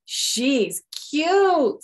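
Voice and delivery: put-on high, loud cooing girl; girl frog voice. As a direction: gush over the baby. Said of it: "said Frogalina".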